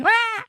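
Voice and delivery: a very high squealing shriek; mock kid scream. Imitating street kids, a sudden high shriek. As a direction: shriek like a child at play.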